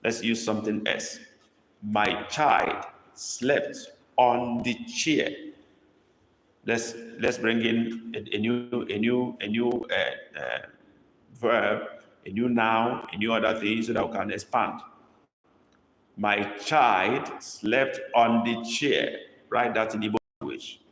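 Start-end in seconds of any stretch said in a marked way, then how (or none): 1.8-5.3 put-on My child slept said word by word, very slow; slow dictation. Shown as a demonstration. Teacher dictating to the class, very slow and clear. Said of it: "dictating a sentence to copy". 16.2-19.2 put-on My child slept said word by word, very slow; slow dictation. Shown as a demonstration. Teacher dictating to the class, very slow and clear. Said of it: "dictating a sentence to copy".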